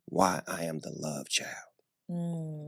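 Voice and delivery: soft, low and near whisper; real hushed emotion, holding back tears; teary-eyed. Real, not acted. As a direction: say it slowly and low, moved by the memory. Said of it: "I'm getting teary-eyed right now".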